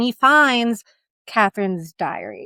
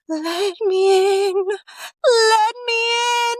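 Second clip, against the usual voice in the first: ghostly wail; 9 semitones higher, much slower than usual. Acted. Ghost girl at the window.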